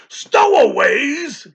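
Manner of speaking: high, slow, loud roar. A narrator's reading. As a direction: roar the word in fury. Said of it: "he roared".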